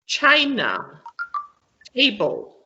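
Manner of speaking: CHI- and TA- stressed in "China, table"; word-list demo, first syllable strong in "China, table". Shown as a demonstration. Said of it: "have the stress on the first syllable".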